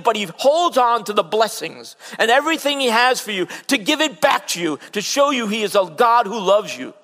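high, loud, driving sermon voice; full-force pulpit preaching; fervent preaching. Acted. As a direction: preach it out with mounting fire.

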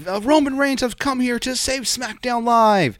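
high, slow, hammed-up commentator hype; mock play-by-play man overselling the moment; imitating Tom Phillips. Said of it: "he was like".

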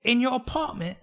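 high-pitched, amazed English voice; mock British accent. English time traveller amazed by modern plumbing, mock British accent.

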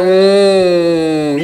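long, low closed-mouth "mm"; mock grunting hum. People passing someone who hurt them, mock low hum. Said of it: "they be like".

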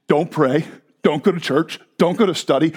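ordinary delivery

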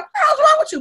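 high, loud mock outrage; indignant. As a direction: snap back at him. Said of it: "normally I was like".

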